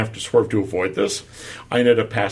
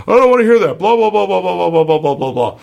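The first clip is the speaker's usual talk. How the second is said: high, loud mocking yell of the cyclist; imitating an angry cyclist; 9 semitones higher, 6 dB louder than usual. Cyclist yelling at him at the light, high loud mock rant. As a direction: shout it like the furious cyclist, mocking them. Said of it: "they started yelling some more".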